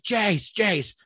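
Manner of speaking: acted, half-volume yell; yelling for son. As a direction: keep calling the name up the stairs. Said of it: "I keep yelling for him".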